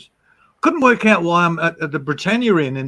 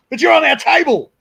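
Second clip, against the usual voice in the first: much higher, loud mock protest; 12 semitones higher, 5 dB louder than usual. To Ray after his apology, high loud protest. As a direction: push back, half joking.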